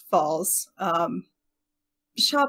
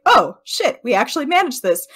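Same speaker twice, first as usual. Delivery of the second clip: higher, louder, quick burst of disbelieving joy; elated cry; 6 semitones higher, 6 dB louder than usual. Acted. Selwyn realizes they won and shouts in delighted disbelief. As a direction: blurt the win out in delight.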